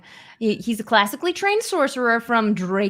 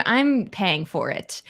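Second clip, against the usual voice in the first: usual speaking voice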